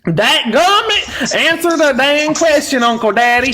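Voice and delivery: high, slow, exasperated Southern yelp; hammed-up Southern exasperation; Southern accent. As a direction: whine it out like a fed-up Southern uncle. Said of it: "Southern accent".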